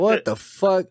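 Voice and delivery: high-pitched retold bafflement; stunned disbelief. Retold shock at drunk boss.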